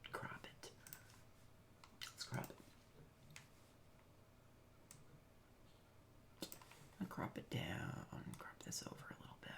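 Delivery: quiet focused whisper. Real, not acted. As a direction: whisper to yourself while working. Whispering while editing.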